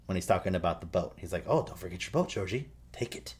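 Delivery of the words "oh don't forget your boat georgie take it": eerie, softly squeaked temptation with rising ends; creepy. Retold or imitated. Clown luring the little boy with his lost boat, creepy. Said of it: "he does that, and it's really creepy".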